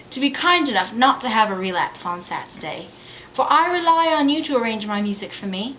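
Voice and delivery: put-on British accent of an English lady in a play. Lady Bracknell lecturing Algernon about Mister Bunbury, British accent. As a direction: read it as a stage monologue in a British accent. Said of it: "about to pull out the British accent".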